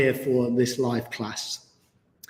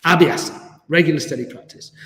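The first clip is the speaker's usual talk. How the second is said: usual speaking voice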